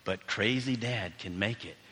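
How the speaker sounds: normal talking voice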